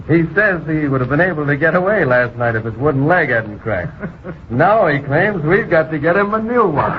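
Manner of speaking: amused laughing. Acted. Chief passes on the prisoner's absurd demand, laughing. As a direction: chuckle through the words, ending in a big laugh.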